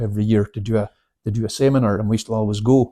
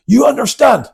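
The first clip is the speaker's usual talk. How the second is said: barked question; 12 semitones higher, 7 dB louder than usual. Retold or imitated. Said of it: "he goes".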